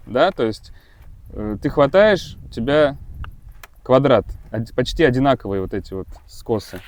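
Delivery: ordinary talk, nothing marked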